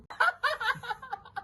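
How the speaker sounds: short high burst of laughter; laughing. Real, not acted. Laughs at his own joke.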